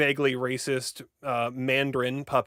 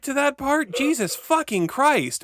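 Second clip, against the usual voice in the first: higher and louder, fed up with being interrupted; annoyed, higher, louder rant; exasperated outburst; 8 semitones higher, 5 dB louder than usual. Real, not acted. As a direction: snap back in mock exasperation. Interrupted while summarizing, exasperated.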